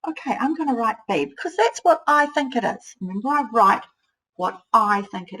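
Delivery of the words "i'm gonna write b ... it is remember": normal talking voice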